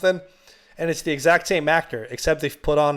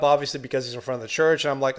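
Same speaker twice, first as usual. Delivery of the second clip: ordinary talk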